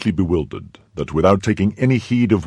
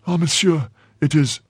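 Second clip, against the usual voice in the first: high-pitched, anxious excuse; flustered servant; 10 semitones higher than usual. Acted. Servant begins explaining to his master, flustered. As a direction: start explaining timidly to the master.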